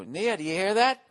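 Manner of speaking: high, rising mock-cheerful call; running-joke call. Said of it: "I always go".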